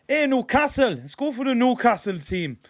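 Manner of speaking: high-pitched attempt at a Geordie accent; mock Geordie accent. Southern football streamer introducing Newcastle's team, mock Geordie accent. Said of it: "I thought that was prime Geordie".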